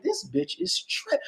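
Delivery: soft, high mock inner voice; quiet catty aside to yourself; hushed inner thought. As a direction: mutter it under your breath, slowed down. Inner thought while staring at someone.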